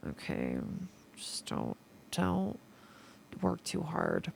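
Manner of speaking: hushed near-whisper warning; soft whispered don't work too hard. Acted. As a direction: breathe it like a secret plea.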